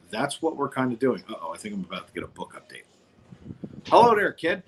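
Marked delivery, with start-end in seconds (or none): 3.9-4.6 high sing-song hello; cheerful greeting. Real, not acted. Greeting daughter on call.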